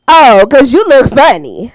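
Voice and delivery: loud, drawn-out imitation of him; mock excited man. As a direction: imitate him slowly and loudly. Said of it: "I knew you was putting on a voice".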